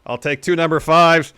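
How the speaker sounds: bully the cashier as the cocky champ; L A Knight. Acted. Ordering at Del Taco, loud brash L A Knight impression. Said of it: "L A Knight's gonna take the lead".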